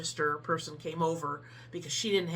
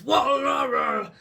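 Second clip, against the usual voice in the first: mock grumbling roar; 6 semitones higher, 8 dB louder, slower than usual. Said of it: "and then he's".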